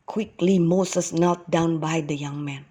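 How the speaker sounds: ordinary delivery, nothing marked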